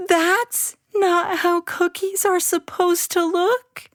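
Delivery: put-on high, wavering disappointed child voice; on the edge of tears; dismayed child. Abby looking at ruined cookies, dismayed.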